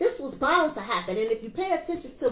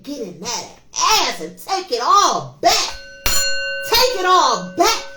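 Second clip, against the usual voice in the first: louder and slower, with a laugh; rousing call to take it all back; rallying cry; 2 semitones higher, 8 dB louder, slower than usual. Real, not acted. Spiritual vlogger rallying her viewers, loud laughing cry.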